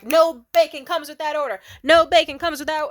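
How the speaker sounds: imitated furious yelling, high and loud; seeing red. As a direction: yell it like an enraged waitress. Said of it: "She was seeing red".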